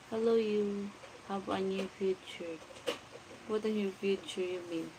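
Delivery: usual speaking voice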